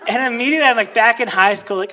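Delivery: ordinary delivery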